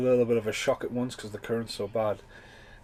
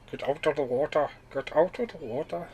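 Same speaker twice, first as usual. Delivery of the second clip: raised, higher lifeguard shout, half hammed-up; mock Jaws beach warning; 5 semitones higher than usual. Quoting the Jaws beach panic to viewers, mock shouted warning. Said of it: "Jaws effect".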